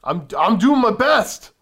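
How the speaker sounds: loud, high, drawn-out protest; exasperated protest. Real, not acted. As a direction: cry out slowly in your own defence. Protesting while reading out viewer questions.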